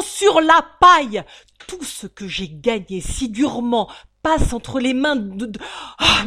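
loud, high desperate ranting; father shouting about ruin; panicked ranting. Acted.

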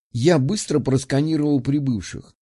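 ordinary delivery

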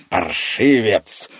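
menacing, drawn-out curse; through clenched teeth. A narrator's reading. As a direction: drag the insult out through the teeth. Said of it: "процедил сквозь зубы старик".

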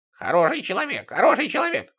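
high quacking radio call-sign. A narrator's reading. Сидорыч calling on his radio, high quacking voice. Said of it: "послышался его крякающий голос".

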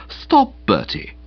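slow, measured reproving "Stop, Bertie"; quiet reproof. Pretend. Boko rehearsing his rescue line, slow mild reproof. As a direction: gently scold a friend, slowly. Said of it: "in a quiet, reproving voice say".

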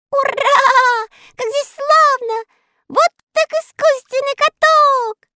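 high, loud elf cheer; cheering shout. Acted. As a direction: shout for joy in tiny voices. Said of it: "закричали все". (радостный крик)

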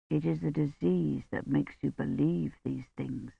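a priest gently calming a dying woman, soft and low. A narrator's reading. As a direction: soothe a feverish woman gently. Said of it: "he said gently".

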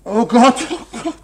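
high, loud laughing despair. Acted. Accused man to the painter, a despairing laughing cry. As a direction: laugh helplessly at the hopelessness.

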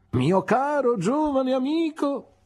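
put-on high, slow, grandly pompous old gentleman; Mr Micawber. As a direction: lift it up and savour each word like a self-important speechmaker. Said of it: "disse mister Micawber".

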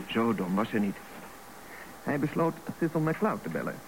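ordinary talk, nothing marked

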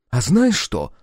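high, loud and challenging; defiant challenge. A narrator's reading. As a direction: throw it down as a dare. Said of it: "с вызовом бросил Сальф".

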